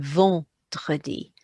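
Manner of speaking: "vendredi" with nasal en and throaty French r; French pronunciation. Shown as a demonstration. Teacher gives French word for Friday, French pronunciation. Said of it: "in the word for Friday".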